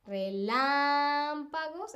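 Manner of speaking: relámpagos with LÁM stretched out; stress on LÁM. Shown as a demonstration. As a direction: lean long on LÁM in relámpagos. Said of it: "es una palabra esdrújula".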